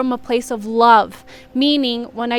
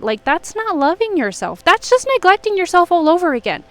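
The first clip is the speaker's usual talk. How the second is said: usual speaking voice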